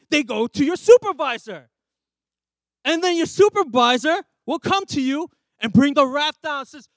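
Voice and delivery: normal talking voice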